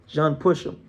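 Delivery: ordinary delivery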